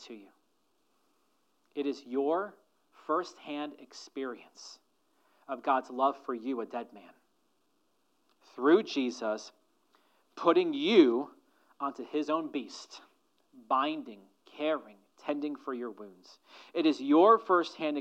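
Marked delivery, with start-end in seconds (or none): none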